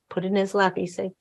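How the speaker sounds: ordinary talk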